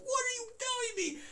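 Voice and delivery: high-pitched amazed demand; re-enacted flabbergasted question; incredulous. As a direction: plead for an explanation, voice way up. Acts out disbelief at friend's revelation.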